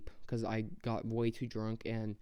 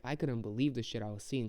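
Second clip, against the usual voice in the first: ordinary delivery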